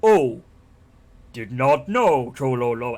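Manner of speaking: loud, slow, dim troll voice; simple-minded troll. Retold or imitated. Troll apologizing to Geralt before walking away, dim and slow. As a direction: imitate a dopey troll realizing its mistake. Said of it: "sometimes the troll is like".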